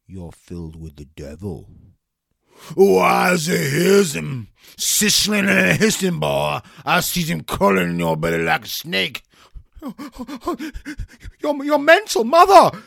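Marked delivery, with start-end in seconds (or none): 2.8-9.2 exorcist preaching. Acted. Exorcist preacher driving out devil. 11.4-12.8 high, loud frightened yell at his mother; panicked boy yelling. Acted. Terrified boy cries out.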